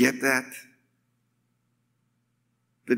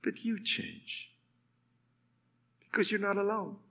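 very soft, intimate aside; hushed reassurance; 4 semitones higher, 15 dB quieter than usual. Real, not acted. Preacher to those facing the jungle alone, hushed. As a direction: drop right down and reassure gently. Reassures after long pause.

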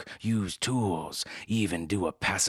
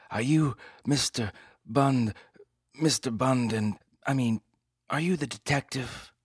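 nervous dame; 6 semitones higher than usual. Acted. Client voiced, higher, hesitant.

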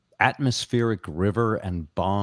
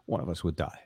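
ordinary talk

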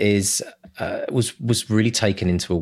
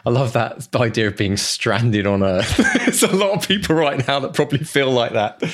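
laughing; 5 semitones higher, 3 dB louder than usual. Real, not acted. Laughing at the idea.

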